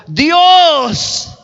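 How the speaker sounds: one high, drawn-out ringing word; voice from above. Retold or imitated. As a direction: announce yourself from on high. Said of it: "de arriba le contesta la voz".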